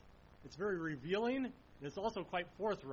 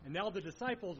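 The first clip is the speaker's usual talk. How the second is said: ordinary talk, nothing marked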